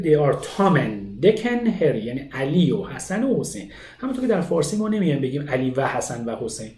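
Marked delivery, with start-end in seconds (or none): 0.0-2.0 said very slowly, the words glued together with a fall on Dick; slow model of the words run together; teacher demo of connected speech; linked native speech. Shown as a demonstration. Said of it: "میاد میگه".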